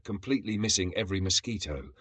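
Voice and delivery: ordinary delivery, nothing marked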